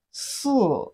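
"si" starting high, dropping sharply; long s, then let "si" fall from high. Shown as a demonstration. Teacher models Chinese four for learners, falling "si". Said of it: "is the falling tone".

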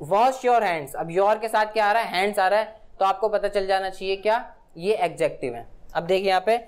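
normal talking voice

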